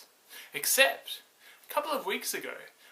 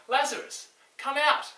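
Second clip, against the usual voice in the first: higher and louder, commanding call; 10 semitones higher, 5 dB louder than usual. Acted. Jesus calling into the tomb to Lazarus, loud command.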